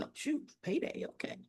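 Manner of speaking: whispered aside, barely any voice; inner thought. Retold or imitated. Martin privately thinking of payday when offered a job, whispered. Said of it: "And Martin's thinking".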